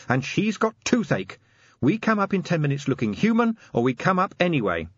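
irritated sergeant laying down the law; Angua, irate sergeant. A narrator's reading. Angua giving ultimatum.

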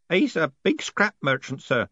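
put-on high-pitched old postman; old postman Groat.

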